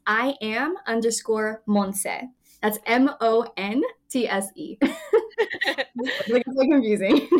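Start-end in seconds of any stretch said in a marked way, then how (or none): 4.8-7.4 laughing through the words, louder. Real, not acted. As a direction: giggle it out. Laughing about her handle.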